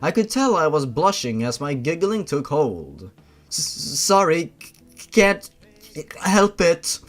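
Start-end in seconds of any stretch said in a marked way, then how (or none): 3.5-7.0 stammered apology. Acted. Apologising to the room mid-fit, stammering and high. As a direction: push the words out through a twitch. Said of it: "between the giggling".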